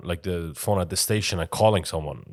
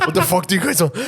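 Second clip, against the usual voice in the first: high, loud imitation of a furious dad; angry shouting; 10 semitones higher, 6 dB louder than usual. Son retelling dad's outburst at the border guards, angry shouting. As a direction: blow up at the guards, half laughing. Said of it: "he starts shouting".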